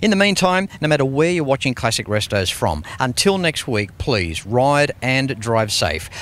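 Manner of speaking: upbeat show-closing announcer read; a lightly voiced TV presenter over music; TV host sign-off. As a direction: wrap up the episode like a car-show presenter.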